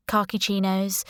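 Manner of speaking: usual speaking voice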